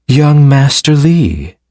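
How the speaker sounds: slow, high, alluring call. Acted. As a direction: draw the name out seductively. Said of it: "an alluring voice sounded in the room".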